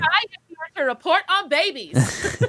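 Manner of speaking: slow, loud mock reporter; news reporter voice. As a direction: announce it like breaking news.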